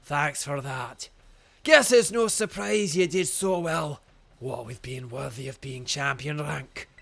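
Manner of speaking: put-on slow, hearty old man in broad Scottish; grand, drawn-out Scottish challenge before battle; Kofu, Scottish accent. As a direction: orate as an old Scottish gym leader, loud line openings. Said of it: "the Scottish accent, yes".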